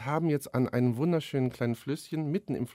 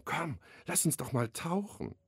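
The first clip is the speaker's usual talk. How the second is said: breathed invitation; 2 semitones higher, 6 dB quieter than usual. A narrator's reading. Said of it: "er begann zu hauchen".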